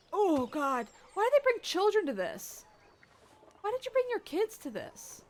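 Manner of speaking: high, loud "why" at the screen; outraged disbelief. Real, not acted. Reacting to children at an execution.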